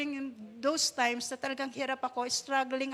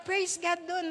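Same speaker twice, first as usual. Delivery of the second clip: ordinary talk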